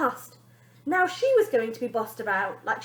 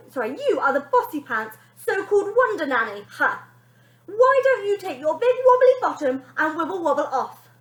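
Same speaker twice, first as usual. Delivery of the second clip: high, loud cheeky insults; rude girl insulting; 7 semitones higher, 10 dB louder than usual. Acted. Girl insulting the nanny's bottom, high and loud. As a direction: sneer it at the nanny.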